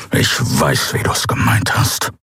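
hissed angry whisper; hissing. A narrator's reading. Kero hisses at Endriel after her jab, angry. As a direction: spit it out under the breath. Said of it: "fauchte er".

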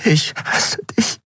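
slow whisper breaking into tears; drawn-out, choked hateful whisper; tearful whisper. A narrator's reading. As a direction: whisper it on the edge of crying. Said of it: "Dann begann sie zu weinen".

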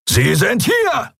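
high, slow bellowed shout; roaring shout. Acted. Albino pirate alerts his gang, roaring shout. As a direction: roar it to the other pirates. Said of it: "brüllte einer von ihnen".

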